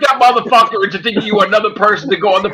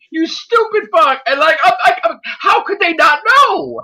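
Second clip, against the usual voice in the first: disbelieving rant; 8 semitones higher than usual. Retold or imitated. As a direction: wail it out in mock despair. Ranting at the stream, retold.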